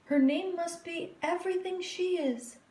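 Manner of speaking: high, light mother voice; mother's voice. A narrator's reading. Mother naming her baby girl, high and fond. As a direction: speak as a proud new mother.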